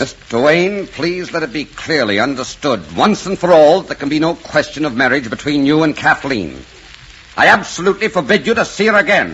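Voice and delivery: angry, emphatic prohibition; stern forbidding. Acted. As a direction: lay down the law, building to an absolute ban. Uncle forbids the suitor.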